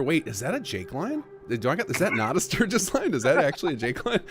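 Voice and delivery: confused second guessing. Retold or imitated. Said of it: "second guessing in my head".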